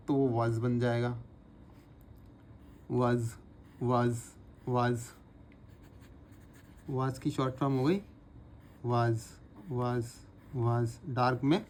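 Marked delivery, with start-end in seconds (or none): 2.9-11.0 वाज with a soft z ending; was with z. Shown as a demonstration. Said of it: "पढ़ते ज़ हैं".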